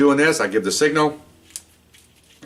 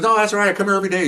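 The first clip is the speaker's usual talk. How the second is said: eager bookie; 11 semitones higher, faster than usual. Retold or imitated. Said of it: "He goes".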